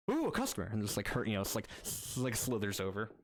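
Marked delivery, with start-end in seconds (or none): 0.1-0.7 high, eager squeal of delight; excited shopkeeper squeal. Acted. Demon clerk greeting a customer with a high eager squeal.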